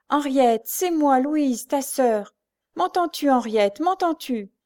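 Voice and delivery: high, loud pleading call; crying out. A narrator's reading. Blind singer calling her lost sister, crying out. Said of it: "je criais à la fin de ma chanson".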